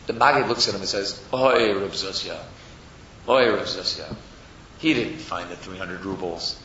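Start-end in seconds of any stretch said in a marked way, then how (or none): 1.3-6.6 slow, low, subdued oy; sighing lament. Acted. Maggid lamenting over his disciple Zusha, sighing. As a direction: let it out slowly and heavily.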